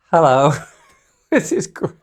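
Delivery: high, loud greeting broken by laughter; losing it mid-impression; cracking up. Real, not acted. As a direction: try to announce it through helpless giggles. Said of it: "I have tried to keep a straight face".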